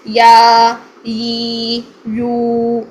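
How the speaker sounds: یا stretched long and loud, ی and یو held; teacher modelling یا with madd. Shown as a demonstration. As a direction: lengthen each vowel for madd. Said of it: "مد کی حالت میں".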